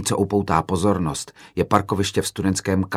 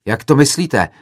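higher, louder indignant question; agitated yell; 6 semitones higher, 6 dB louder than usual. A narrator's reading. Another student to the filmmaker, agitated yell. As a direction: demand an explanation, riled up. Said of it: "zařval rozčileně jiný student".

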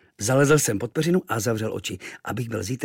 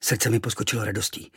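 ordinary delivery, nothing marked

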